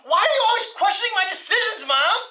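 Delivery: high, fast whine at mom; whiny teenager. Acted. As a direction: complain like a sulky teen.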